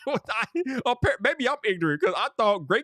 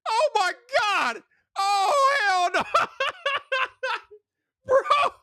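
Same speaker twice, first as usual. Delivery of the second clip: laughing outrage; 13 semitones higher, slower than usual. Retold or imitated. Customer shouting at the barbershop price, laughing outrage. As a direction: howl at the bill, laughing.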